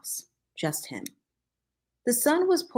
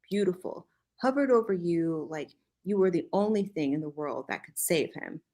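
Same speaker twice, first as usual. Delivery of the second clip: plain reading voice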